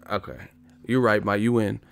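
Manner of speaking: ordinary talk, nothing marked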